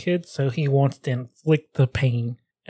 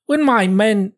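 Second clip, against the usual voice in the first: high-pitched attempt at a British accent; amateur British accent, pitched up; 7 semitones higher, 3 dB louder, slower than usual. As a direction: try a raised, lightly British voice. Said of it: "have a British accent".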